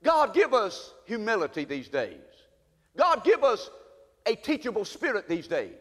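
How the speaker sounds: fervent pastoral prayer. Acted. Pastor praying over the church, fervent. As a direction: pray it for the whole church.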